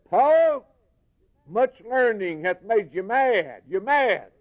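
high, slow, scoffing jeer; scornful accuser. Retold or imitated. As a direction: sneer it like a man calling Paul mad. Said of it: "that fella told Paul he was crazy".

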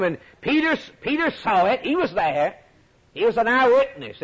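impassioned pulpit storytelling; preaching. Real, not acted. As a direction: drive the story home to the church. Preacher builds again.

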